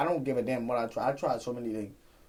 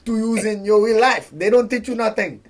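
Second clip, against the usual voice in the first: heated rant; 6 semitones higher, 8 dB louder than usual. Real, not acted. Ranting about school.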